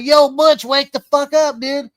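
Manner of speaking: high loud shout, fading on dude; yelling. Retold or imitated. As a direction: bark at him to wake up. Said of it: "And I start yelling".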